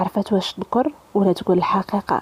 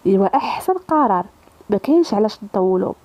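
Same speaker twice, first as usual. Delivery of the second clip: ordinary delivery, nothing marked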